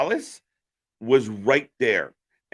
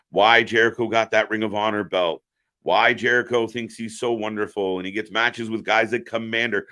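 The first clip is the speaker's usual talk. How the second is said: normal talking voice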